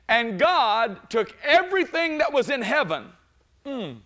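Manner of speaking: preacher rising to a shouting peak; preaching at full force. Acted. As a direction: lift the church with each phrase.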